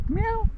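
high imitation meow; mock meow. Walker calling a cat with a high mock meow. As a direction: mew up high to lure the cat over.

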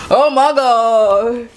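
slow, loud drawn-out amazement; exaggerated cartoon intonation. Real, not acted. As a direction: stretch out the awe. Admiring fried chicken.

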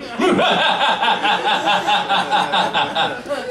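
long, staccato evil cackle; supervillain laugh. Retold or imitated. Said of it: "She laughed like a supervillain".

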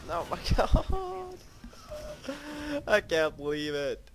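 high-pitched incredulous laugh; laughing disbelief. Real, not acted. Laughing at own bad play.

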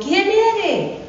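a small goat asking the wolf who is knocking; little goat kid. Acted. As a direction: ask like a wary little goat. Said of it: "le dicen los cabritos".

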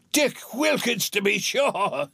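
high-pitched, excited exclamation; delighted recognition. A narrator's reading. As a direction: greet the sight of an old friend with joy. Scrooge delightedly recognizes old friend.

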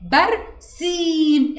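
برسيم with the long i held very slowly; teacher draws out the سي of برسيم; stretched demo. Shown as a demonstration. Teacher demonstrates madd to pupil, stretched vowel. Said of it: "بصي كده وأنا بقولها".

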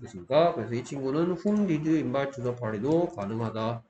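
usual speaking voice

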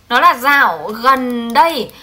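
ordinary talk

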